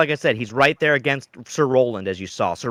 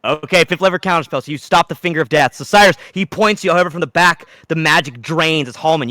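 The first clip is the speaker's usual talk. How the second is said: ordinary delivery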